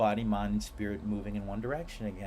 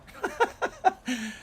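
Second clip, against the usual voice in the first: squealing high laugh. Real, not acted. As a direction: burst into a gleeful laugh. Laugh after gold star.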